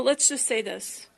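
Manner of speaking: ordinary talk, nothing marked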